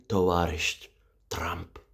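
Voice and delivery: whisper. Acted. Whispered Tovarish Trump.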